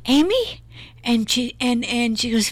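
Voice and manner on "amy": alarmed call. Retold or imitated. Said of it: "I'm like".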